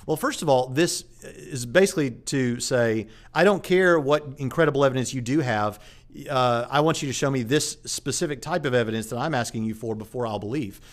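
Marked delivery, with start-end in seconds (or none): none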